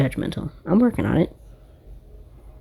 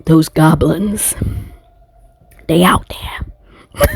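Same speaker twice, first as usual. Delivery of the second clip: slow, eerie whisper; much slower than usual. Acted. Warning viewers about goblins in a slow whisper. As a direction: breathe it out like a spooky secret.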